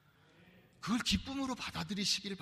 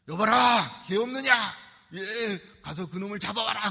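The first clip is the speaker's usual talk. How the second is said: put-on much louder commanding shout of an old-time father; stern father's bellow; 3 semitones higher, 9 dB louder than usual. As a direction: roar for the servant to fetch the son. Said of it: "호통을 치는 것이".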